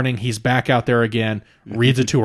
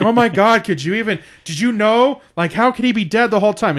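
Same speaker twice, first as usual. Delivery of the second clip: high-pitched, hammed-up disbelief; mock scream; 10 semitones higher, 3 dB louder than usual. Acting out her shock at The Sixth Sense, mock screaming. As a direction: imitate someone loudly floored by a plot twist. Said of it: "She's, like, screaming at it".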